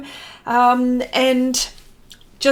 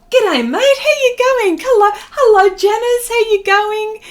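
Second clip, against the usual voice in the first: high, loud sing-song hellos; chirpy livestream welcome; bubbly greeting; 12 semitones higher, 6 dB louder than usual. Real, not acted. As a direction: greet each friend like they just walked in. Greeting viewers arriving in chat.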